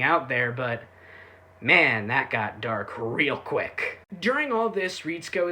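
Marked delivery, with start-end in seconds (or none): 1.6-4.0 slow deadpan. Real, not acted. Deadpan comment after dark turn.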